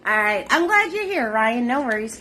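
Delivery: usual speaking voice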